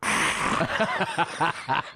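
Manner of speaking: breathy high gasp with a laugh; mock her stunned little ah; mimicked gasp. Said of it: "she went".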